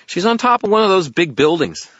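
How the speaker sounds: high, loud little fairy. Acted. Fairy directs wizard to buildings, high fairy voice. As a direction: pipe it out matter-of-factly, high.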